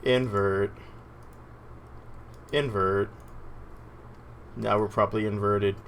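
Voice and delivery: ordinary talk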